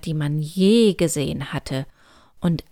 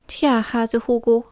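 lightly voiced higher elf; 5 semitones higher, 3 dB louder than usual. A narrator's reading. Elf replies to Hugo, mildly knowing tone. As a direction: answer in a slightly raised elf voice. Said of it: "antwortete Emilia".

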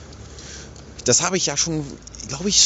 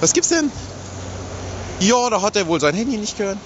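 higher-pitched imitation of the wife; imitierte Ehefrau am Telefon; 7 semitones higher than usual. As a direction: play the wife's half of a phone call, voice raised in pitch. Spielt Telefonat der Ehefrau nach. (nachgemachte Ehefrau, hohe Stimme)

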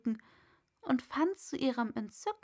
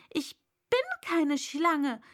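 raised, louder indignant denial; 5 semitones higher, 5 dB louder than usual. A narrator's reading. Alice indignantly denying being a snake. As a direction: protest it, offended. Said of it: "sagte Alice mit Entrüstung".

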